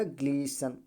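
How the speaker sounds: ग्लीसन as "glee-san", t silent; correct pronunciation. Shown as a demonstration. Teacher giving students the right reading, silent t. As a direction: say ग्लीसन smoothly with no t. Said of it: "क्या पढ़ा जाएगा".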